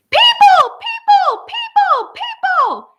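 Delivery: very high, loud squeaky screams, fading; creatures screaming. A narrator's reading. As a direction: shriek it like tiny creatures in alarm. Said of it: "as loud as they could".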